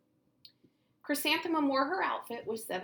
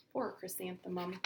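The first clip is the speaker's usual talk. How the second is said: usual speaking voice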